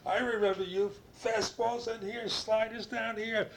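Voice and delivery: high, loud excited impression of Charlie; excited recognition. Charlie Manuel recalling the pitcher's fastballs and sliders, high and excited. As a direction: pitch way up, thrilled to place the old pitcher.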